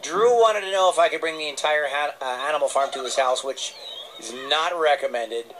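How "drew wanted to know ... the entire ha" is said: usual speaking voice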